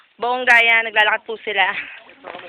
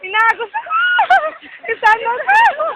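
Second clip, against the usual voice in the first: high panicked yelling, swept away; panicked shouting; 12 semitones higher, 3 dB louder than usual. Real, not acted. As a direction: yell in real alarm as the current takes you. Swept by strong river current.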